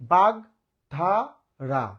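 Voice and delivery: বাগধারা very slowly, in three separate beats; syllable by syllable. Shown as a demonstration. Teacher confirming students' answer, saying বাগধারা slowly in three parts. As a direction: pull বাগধারা apart into three syllables with clear gaps. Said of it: "তিনবারে উচ্চারণ করলাম".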